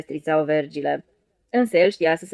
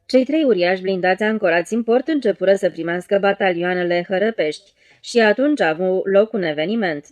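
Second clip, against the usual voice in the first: usual speaking voice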